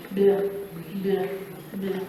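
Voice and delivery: "brr" as a separate low blend sound; consonant blend demo. Shown as a demonstration. Teacher showing how to pronounce blends, low isolated "brr". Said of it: "that's how you're supposed to pronounce".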